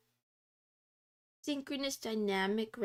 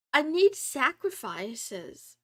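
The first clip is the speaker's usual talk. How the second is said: slow, louder, hammed-up vampire craving; drawn out like a playful vampire; mock vampire demand; 7 dB louder, slower than usual. Joking she is a vampire.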